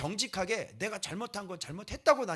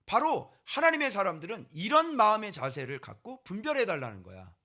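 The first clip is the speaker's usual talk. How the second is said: ordinary talk, nothing marked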